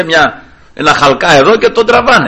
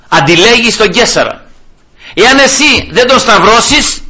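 raised pitch, deliberate spaced-out phrases; pressing accusation; 6 semitones higher than usual. Acted. Accusers force Pilate's hand over Caesar, pressing hard. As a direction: corner the listener clause by clause.

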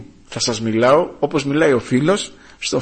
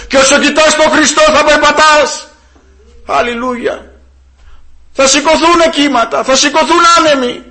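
high, raised preacher's voice; full-force preaching; 11 semitones higher, 7 dB louder than usual. Real, not acted. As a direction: drive the exhortation home to the congregation. (έντονο κήρυγμα με υψωμένη φωνή)

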